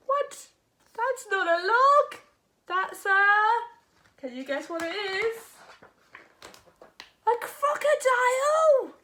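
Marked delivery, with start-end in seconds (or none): 0.1-3.3 high, loud shocked little frog; startled frog. Acted. Frog discovers crocodile. 7.3-8.9 high, loud cry of fright; alarmed cry. Acted. Frog reveals crocodile.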